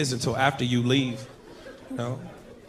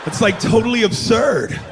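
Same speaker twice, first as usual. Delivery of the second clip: mock valley-dude voice; 3 semitones higher, slower than usual. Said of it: "He was like".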